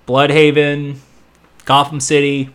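ordinary talk

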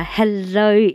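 ordinary talk, nothing marked

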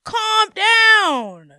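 high, loud sarcastic calm down; mocking calm down. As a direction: yell calm down at the teacher mockingly. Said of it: "teachers that would get mad".

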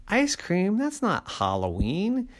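high, questioning disbelief; incredulous dad. Acted. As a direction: balk at the kids' request. Daddy incredulous at request.

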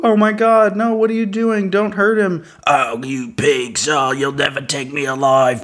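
high, frantic cries of a man being arrested; panicked arrest scene. Pretend. Bystander acting out a police arrest, high panicked protest. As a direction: plead and resist like you're being dragged off.

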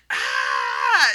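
loud, drawn-out wordless yell; frustrated caveman holler; caveman scream. Retold or imitated. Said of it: "I'm just screaming like a ... a caveman".

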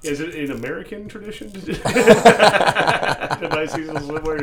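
laughing through a question; a real laugh cracking the words. Real, not acted. Cohost laughing while asking about swimwear.

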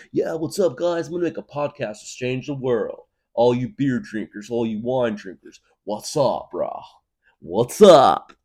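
high-pitched hype, last greeting yelled; hyped bro podcaster. Acted. Podcaster hero greets beer and wine drinkers, hyped-up bro voice. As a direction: greet the crowd as an eager bro.

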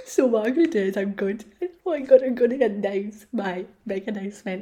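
loud, excited rush of words. Real, not acted. Podcaster about to make her big announcement, excited. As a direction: burst out loudly with big news. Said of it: "I'm so excited".

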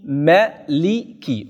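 "Maliki" slowly split into three syllables. Shown as a demonstration. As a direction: sound out "Maliki" with a short first vowel, slowly. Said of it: "Volgens Warsh".

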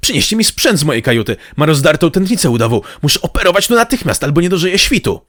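loud, high, rising alarm; urgent shout. A narrator's reading. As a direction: shout orders as a medic in an emergency. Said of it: "i krzyknął". (nagląco, krzykiem, wysoko)

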